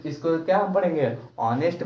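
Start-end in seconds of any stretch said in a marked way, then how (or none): 1.4-1.8 ऑनेस्ट with a silent H; correct honest. Shown as a demonstration. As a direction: start ऑनेस्ट straight on the vowel "o". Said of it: "पढ़ते हैं".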